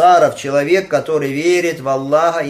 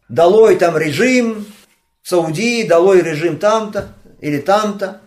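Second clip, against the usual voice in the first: higher, louder imitation of chanted slogans; mock protest shouting; 5 semitones higher, 5 dB louder, slower than usual. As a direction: call out slogans like the foolish crowd. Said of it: "которые кричат". (передразнивает крики толпы)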